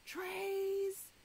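high, drawn-out yell; yelled call. A narrator's reading. Kitchen staffer yells over the conveyor belt, high call. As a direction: call it out on a sustained high note. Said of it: "and yelled".

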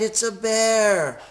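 high, drawn-out wail of alarm; high-pitched storybook cry of fear. A narrator's reading. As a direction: stretch it out in hammed-up panic. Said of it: "they cried".

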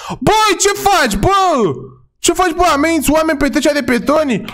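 high-pitched indignant police scolding; mock outraged shouting. Officer to man threatening pedestrians, mock outraged high yelling. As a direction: confront the culprit in hammed-up outrage. Scolds and arrests game suspect.

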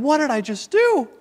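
retold panic over a screaming toddler; panicked thoughts. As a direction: spiral into a dad's alarm. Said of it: "and I'm thinking".

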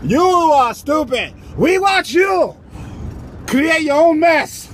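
high, drawn-out emphatic jeer; exaggerated Mexican-accented taunt; mocking Mexican. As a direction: call it out slow and high. Acted Mexican mocking the Americans.

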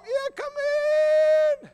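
very high, thin put-on call; calling from inside. Older householder answers the preacher's knock, calling in a high voice.

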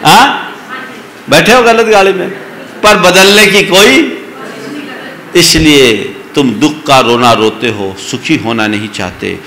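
normal talking voice

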